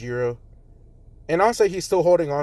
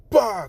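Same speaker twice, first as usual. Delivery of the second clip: loud, high bah of a cartoon crash; cartoon smack; 7 semitones higher, 5 dB louder than usual. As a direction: slam into an invisible wall. Uraraka smacks into wall.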